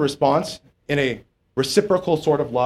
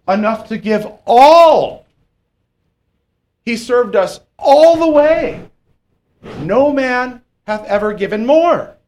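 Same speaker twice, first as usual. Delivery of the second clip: much higher, louder, slow preaching swell; preaching at full force; 9 semitones higher, 7 dB louder, slower than usual. Acted. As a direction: build to the peak of the sermon, slow and loud. Preaching climax on Christ's love.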